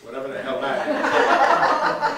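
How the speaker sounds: loud laughing remark, slowed; laughing aside. Real, not acted. Narrator cracking up at the line, laughing loudly. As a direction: laugh right through the aside.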